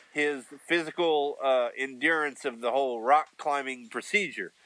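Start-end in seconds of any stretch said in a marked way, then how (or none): none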